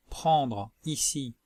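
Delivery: ordinary talk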